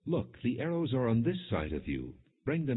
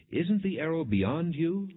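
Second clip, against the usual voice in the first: called out high and a bit louder; calling out; 9 semitones higher, 3 dB louder than usual. A narrator's reading. Jonathan calling after the boy in the field, raised voice. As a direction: call after the running boy. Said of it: "Jonathan called out after him".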